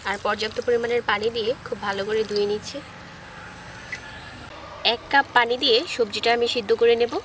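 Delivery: ordinary talk, nothing marked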